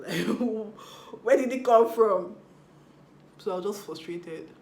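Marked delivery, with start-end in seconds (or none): 0.0-2.3 laughing, then a loud incredulous question; laughing disbelief. Real, not acted. To viewers, laughing at her own bad behaviour. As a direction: burst out laughing at yourself, then ask loudly.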